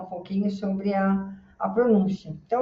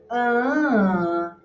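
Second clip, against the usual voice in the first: "ah" as one long raised note that rises then drops; melody of second-syllable stress; 4 semitones higher than usual. Shown as a demonstration. Teacher hums the word's stress for students, melody of second-syllable stress. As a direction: sustain a high "ah" to trace the stress. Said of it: "a sílaba tônica é o segundo".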